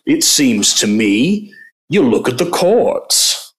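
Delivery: mock McConnell accent. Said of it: "I'm gonna do the accent".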